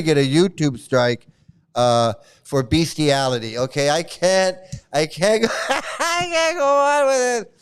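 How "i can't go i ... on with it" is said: too overcome with laughter to continue; cracking up. Real, not acted. Breaks down laughing while reading.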